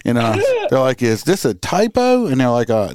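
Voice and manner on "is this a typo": imitating a caller's disbelief, pitched high; mock incredulity, voice jumping up; incredulous caller. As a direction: retell it as a high-pitched, baffled question. Acting the caller disputing the fine.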